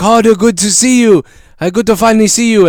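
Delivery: put-on light Japanese accent, rising question; eager waiter asking in a Japanese accent. Waiter asking customer to order, mild Japanese accent. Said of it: "He's like".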